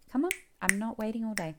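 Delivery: mother demanding he show what he's hiding, impatient. Acted. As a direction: snap it at him, out of patience.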